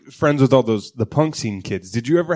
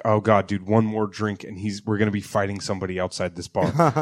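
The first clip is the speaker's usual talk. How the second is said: worried mutter; 8 semitones lower, 7 dB quieter than usual. Retold or imitated. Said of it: "you're like".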